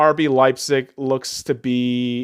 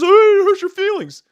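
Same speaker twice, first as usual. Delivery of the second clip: high, loud sneering mock of the woke crowd; mocking whine; 5 dB louder than usual. Mocking critics who cancel the name, high sneer. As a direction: sneer it like a crybaby. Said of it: "you motherfuckers just wanna cancel because".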